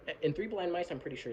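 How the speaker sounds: normal talking voice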